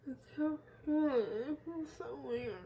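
numb mouth, slurred words; numb from the dentist. Real, not acted. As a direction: talk through a numb mouth. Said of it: "And talk really weird".

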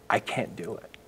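low, hushed admission of defeat; retold prayer, trailing into near silence; broken man praying. Husband praying about his wife, quiet despair. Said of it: "I'm just a broken man".

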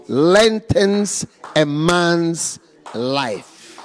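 very slow, weighty; slow solemn verse reading; drawn out. Shown as a demonstration. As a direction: stretch every word of the verse. Verse repeated slowly.

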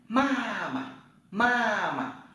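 ma mà said high, the second syllable rising; Chinese tone drill. Shown as a demonstration. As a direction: model ma mà slowly for learners. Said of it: "Mẹ".

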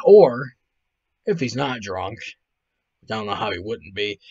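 ordinary delivery

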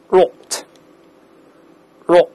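rot with a rounded British "o" vowel. Shown as a demonstration. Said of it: "在英式发音里面发o这个声音". (英式发音，rot读圆唇的哦音)

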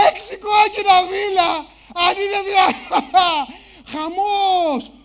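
high, loud hooting through laughter; mocking audience laughter. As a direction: imitate a crowd helpless with mocking laughter. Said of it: "Όλο το ακροατήριο να γελάει".

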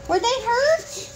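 very high, slow question; exaggerated high-pitched concern; insistent concerned question. Real, not acted. Repeats the question to child.

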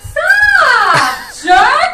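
shout. Real, not acted. Yelling at someone upstairs.

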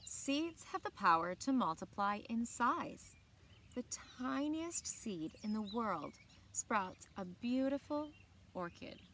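put-on reading a picture book aloud to children; bright, clear read-aloud voice; story narration. A narrator's reading. Teacher reading a book to the class, in a storytelling voice.

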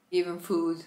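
normal talking voice

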